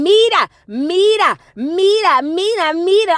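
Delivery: high, drawn-out calling of a name; calling kids out. Retold or imitated. Mother calling to her twins on their bikes, drawn out. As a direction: call out to the kids outside, nagging. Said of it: "hear her in the background saying".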